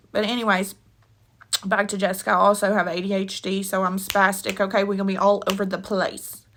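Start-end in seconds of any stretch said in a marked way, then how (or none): none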